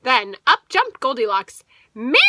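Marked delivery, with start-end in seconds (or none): 1.9-2.2 a loud cheeky shout; yell. Acted. Goldilocks yelling back at the bears. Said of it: "she yelled".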